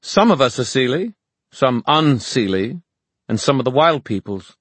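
high, loud, deliberate speech; proud fairy woman. Acted. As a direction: proclaim each group with pride.

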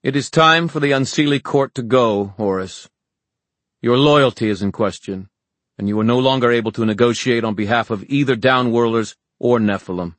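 icy public authority; cold, ringing. A narrator's reading. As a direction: declare the verdict in a ringing voice. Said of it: "spoke coldly in a ringing voice".

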